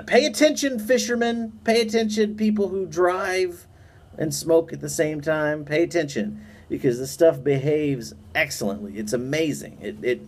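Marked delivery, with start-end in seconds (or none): 0.0-6.4 raised, emphatic address to listeners; emphatic call-out. Real, not acted. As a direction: call out to the viewers. Calling out to the audience.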